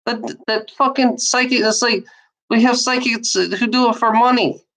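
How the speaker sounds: ordinary delivery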